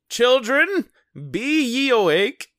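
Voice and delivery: put-on high, loud old-timey parent voice; hammed-up parent summons; mock parent call. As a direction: call out like a stern pilgrim parent. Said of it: "they do the parent thing".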